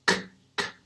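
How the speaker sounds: unvoiced "K, K" with air held then released; voiceless "K" stop. Shown as a demonstration. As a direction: click out "K" from the throat, no voice. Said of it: "Perceba que a gente fecha o ar".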